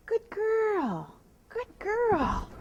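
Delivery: bright high sing-song praise to the horse. Real, not acted. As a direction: reward her with a lifted, happy voice. Praising horse after jump up.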